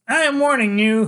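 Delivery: put-on high, loud fierce kid's threat; a small boy bravely warning the villain; fierce, bold. Freak warning Killer Kane, high, loud and fierce. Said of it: "he says in a fierce, bold way he has".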